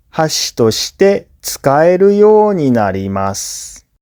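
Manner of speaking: ordinary talk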